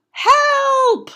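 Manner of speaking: high, loud cry for help; yelling for help. Acted. Amelia Bedelia tangled in bats, loud high call for help. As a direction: call out in alarm. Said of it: "yelled Amelia Bedelia".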